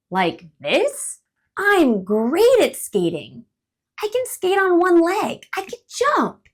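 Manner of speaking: boastful squirrel. Acted. Boastful show-off squirrel. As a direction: squirrel shows off skating to bird.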